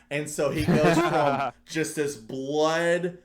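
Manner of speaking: laughing through the story, loud and slow; can barely get the words out for laughing. Real, not acted. Laughing while retelling blooper.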